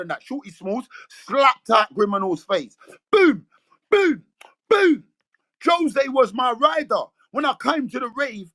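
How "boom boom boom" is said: slap sounds. Retold or imitated. Imitating the slaps landing.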